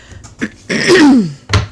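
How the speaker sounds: loud, sharp throat clear. Real, not acted. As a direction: clear your throat hard. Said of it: "Excuse me, frog in my throat".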